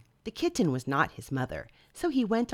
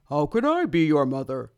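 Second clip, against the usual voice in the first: cow voice; 2 semitones lower, 8 dB louder than usual. Acted. Cow answers baby bird.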